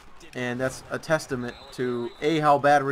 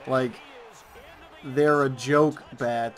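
ordinary talk

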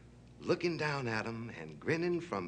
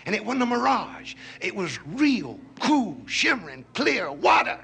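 higher, louder, punching each word; excited storytelling; 8 semitones higher, 6 dB louder than usual. A narrator's reading. Storyteller revealing a real pool of water, excited. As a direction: build up the wonder of the find.

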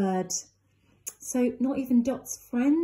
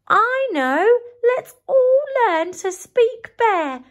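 high, louder bird voice; eager Dot; 10 semitones higher, 4 dB louder than usual. Acted. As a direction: announce the plan brightly. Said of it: "said Dot".

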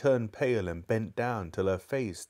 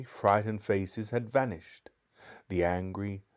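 ordinary delivery

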